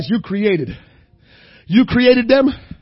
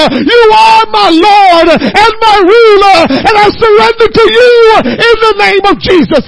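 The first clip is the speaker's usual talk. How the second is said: fervent prayer; 5 semitones higher, 9 dB louder than usual. Shown as a demonstration. Preacher modelling a surrender prayer, building to crying out. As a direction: pray it out until the voice breaks. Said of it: "First you say".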